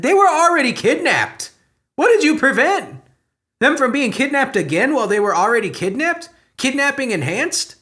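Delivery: high, loud sarcastic disbelief; incredulous mockery. Real, not acted. Mocking the sheriff's press quote, high and incredulous. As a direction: scoff at the sheriff's logic.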